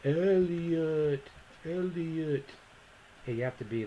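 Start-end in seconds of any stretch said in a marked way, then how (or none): 0.1-2.5 high, drawn-out alien calling a name. Acted. Instructor quoting the film as a joke, E.T. voice. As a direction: stretch each syllable like a little movie alien. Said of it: "This reminds me of E T".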